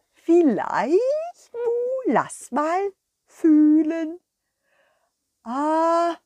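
high, mooing cow with a proud tone; a sweet cow showing off her find; cow puppet. Acted. The cow Kurita proudly presenting her little plate.

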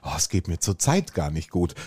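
low, downcast begging-off; suggested sad excuse. Shown as a demonstration. Co-host suggesting a better excuse to dodge coffee, downcast. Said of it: "du würdest sagen".

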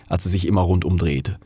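ordinary delivery, nothing marked

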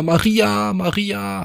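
high-pitched call across the house. Acted. Husband calling his wife from the bedroom, high call. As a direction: call her name from afar. Calls again closer.

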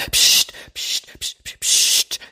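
put-on whispered psst psst to hush a baby; mock shushing. Parent hushing a crying baby, whispered mock shushing. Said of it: "wie wenn ein Baby schreit".

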